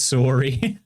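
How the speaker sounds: "Sorry" with a long round O, SORE-ee; Canadian O. Retold or imitated. Streamer imitating how he says it, "Sorry" as SORE-ee. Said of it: "He says, "Sorry." ... All the O's".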